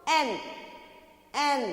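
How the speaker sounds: एन as one short syllable, repeated after a pause; pronunciation demo. Shown as a demonstration. Teacher showing how to pronounce, एन said as an isolated syllable. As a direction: model एन separately, twice. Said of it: "How to pronounce?".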